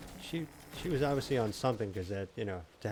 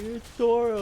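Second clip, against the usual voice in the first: loud, high mock wail; imitated crying daughter; 13 semitones higher, 9 dB louder, slower than usual. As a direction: wail like an upset little girl. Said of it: "like, crying".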